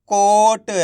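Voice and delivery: कोट with a long held "o" and light final ट; half final letter. Shown as a demonstration. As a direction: stretch कोट like a reading teacher, halving the ट. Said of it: "last वाले अक्षर का उच्चारण आधा किया जाता है". (कोट को खींचकर, आखिरी ट आधा)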